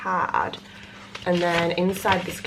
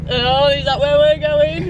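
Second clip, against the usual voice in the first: slow put-on Irish accent, a touch higher; 7 semitones higher, 7 dB louder, slower than usual. Pretending not to know the trip, mock Irish accent. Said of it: "I needed to do a fucking Irish accent".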